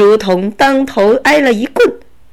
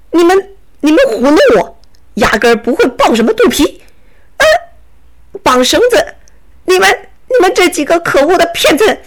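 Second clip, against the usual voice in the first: angry robber; 5 semitones higher, faster than usual. Acted. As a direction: bluster like a tricked bandit. Robber shouts at his captors. (强盗气急败坏地嚷)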